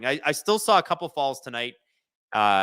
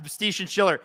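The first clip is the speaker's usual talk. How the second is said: normal talking voice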